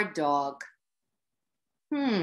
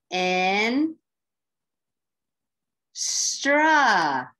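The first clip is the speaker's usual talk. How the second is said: 'In', pause, then a louder, drawn-out 'stra'; stretched-out syllables; 3 dB louder than usual. Shown as a demonstration. Teacher stretching syllables for readers, slow and separated. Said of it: "Let's stretch it out".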